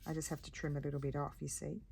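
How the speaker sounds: ordinary delivery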